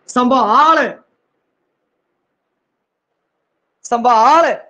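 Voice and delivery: ਸੰਭਾਲ with a full breathy bh, no tone; ਸੰਭਾਲ, the bh pressed out whole; full aspirated bh. Shown as a demonstration. Teacher to students, demonstrating the full bh in ਸੰਭਾਲ. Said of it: "ਭੱਬਾ ਪੂਰਾ ਟਿਕਵਾ ਠੁਕ ਰਿਹਾ".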